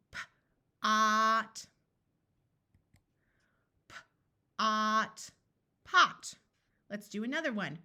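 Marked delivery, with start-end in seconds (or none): 0.1-5.3 P-ot said slowly, the P held apart from ot; slow stretched-out word. Shown as a demonstration. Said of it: "I'm going to say a word slowly". 5.8-6.3 Pot said quickly as one blended syllable; quick blended word. Shown as a demonstration. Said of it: "Say it fast".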